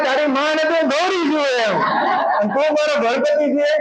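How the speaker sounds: high, sweet voice of a doting mother; mother telling her child he is her Ganpati, said high and sweet; loving mother to child. Retold or imitated. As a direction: coo it to your little one as a mother would. Mother voicing loving words to child.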